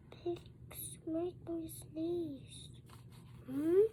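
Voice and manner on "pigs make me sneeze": whisper. Acted. Secret confession whispered.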